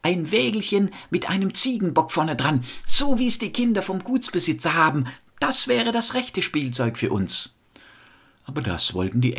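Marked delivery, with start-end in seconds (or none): 0.0-7.5 put-on high-pitched, eager child voice; storyteller playing delighted kids; children calling out. A narrator's reading. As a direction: pipe up like excited children. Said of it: "und die riefen".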